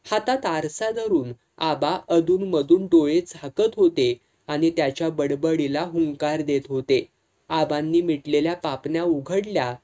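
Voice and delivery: ordinary delivery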